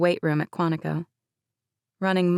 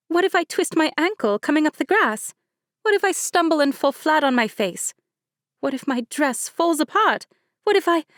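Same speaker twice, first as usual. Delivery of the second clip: high, rising list of worries; nervous panic; 10 semitones higher, 3 dB louder than usual. A narrator's reading. Fidgety bride frets to her cousin about disaster. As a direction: spiral into wedding-day dread.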